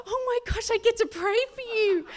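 gasping, high-pitched, loud excitement; thrilled outburst. Retold or imitated. Running over to pray for homeless person, thrilled outburst. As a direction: squeal it out in overjoyed excitement.

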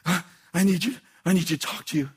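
pleading, overdramatic voice; dramatic begging; dramatic plea. Retold or imitated. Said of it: "he's really dramatic".

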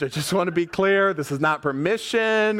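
normal talking voice

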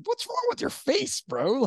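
imitated coworker, high-pitched and incredulous; mock disbelief. Coworker seeing the fresh shave, mock-incredulous. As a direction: blurt it high, as if baffled by the sight.

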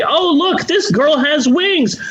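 high, gushing fake delight; sarcastic cooing with a fake laugh; mock admiration. Host mocking fans of the winged girl, gushing sarcastically. Mocks admirers of a pretty power.

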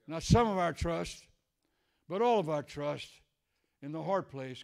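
loud, rising preacher's cry; full-force sermon delivery; preaching. Acted. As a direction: preach it out to the congregation.

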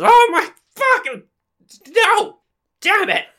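very high shrieking character voice; high-pitched startled screaming; panicked scream. Acted. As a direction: shriek in a comic panic, falling over. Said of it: "And then he screams and he falls over".

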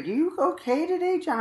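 mock the friend asking kindly; lightly voiced friend checking in on John; caring check-in. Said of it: "you're like".

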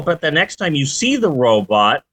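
ordinary talk, nothing marked

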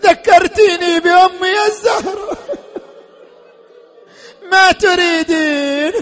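sobbing lament to a sister; heartbroken, tearful plea; tearful grief. Acted. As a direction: let the voice break into weeping at phrase ends. Grieving Hussein addresses his sister.

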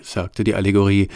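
ordinary delivery, nothing marked

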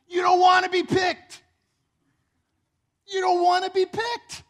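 high, loud mock yell; mocking shout. Jealous crowd mocking their own choice, loud and high. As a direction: shriek it like a jealous mob.

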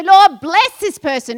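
loud, slow, confident prayer; bold prayer. Shown as a demonstration. Preacher showing the congregation the bold way to pray, loud and slow. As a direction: pray it out big and sure.

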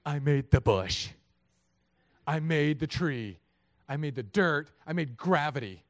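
loud, weighty declarations with drawn-out words; booming mock-divine rebuke; incredulous God booming. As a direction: thunder it like an exasperated Creator. God answers people hiding from him.

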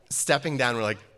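ordinary delivery

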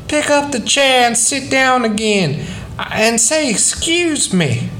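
a hint of a stern mom, calling; calling mother. Mama calling her son back to the table, lightly voiced mother.

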